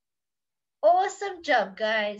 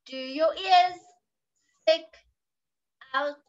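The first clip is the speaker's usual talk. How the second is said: ordinary delivery, nothing marked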